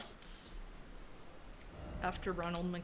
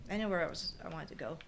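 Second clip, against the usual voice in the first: ordinary delivery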